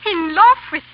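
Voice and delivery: indignant disbelief, thrown back at him; startled protest. Acted. Wife to husband, denying her love, startled and loud.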